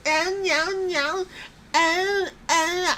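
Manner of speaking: high, whiny mock cries of oh no; mock whiny wrestler. Vlogger mocking Liv Morgan's whining, high and whiny. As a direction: whine it like a spoiled brat, pitched high.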